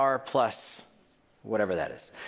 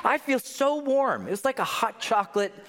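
sarcastic gushing; 7 semitones higher than usual. Acted. Preacher pretending grim verse comforts him, sarcastic. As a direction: gush as if comforted, clearly ironic. Sarcasm, then admits we don't.

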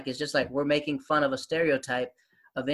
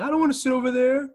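high, loud whine of an awkward pupil; high-pitched kid's protest; 11 semitones higher, 6 dB louder than usual. Acted. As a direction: play an embarrassed schoolkid protesting, pitched way up. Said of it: "they're awkward".

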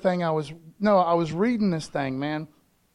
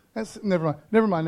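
ordinary talk, nothing marked

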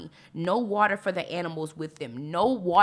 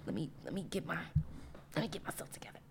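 softer, near-whispered self-talk; composing herself; 12 dB quieter, much faster than usual. Real, not acted. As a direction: pull it right down, gathering yourself. Composing herself after excited outburst.

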